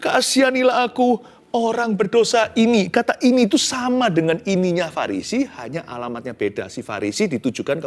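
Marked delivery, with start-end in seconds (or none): 0.0-2.9 lightly voiced, higher pleading plea; tax collector's prayer. Preacher voicing the repentant tax collector, pleading. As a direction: plead with God, raised and louder. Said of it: "Dia berkata".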